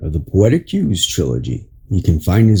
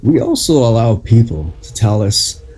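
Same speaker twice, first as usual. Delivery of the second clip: inspirational reading; 4 semitones higher than usual. Acted. Reads an inspirational article aloud.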